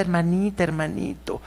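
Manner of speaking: mock syrupy hermanita. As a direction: coo it like a fake-pious sister. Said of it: "pero que hablan así". (voz empalagosa y suave de hipócrita)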